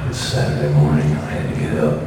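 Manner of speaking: a tired hard-boiled detective voice-over, low and soft; dry gritty noir. Shown as a demonstration. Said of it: "that kind of dry, gritty stuff".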